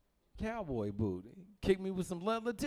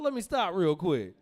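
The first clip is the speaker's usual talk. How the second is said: ordinary talk, nothing marked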